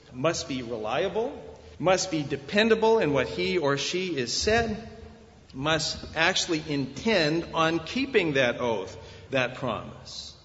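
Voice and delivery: ordinary delivery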